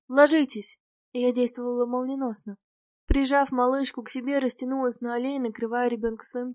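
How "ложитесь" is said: louder narrated shout, kept brief; a guard's raised-voice command; shouted warning. A narrator's reading. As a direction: call out an urgent order to take cover. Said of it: "Алексей крикнул".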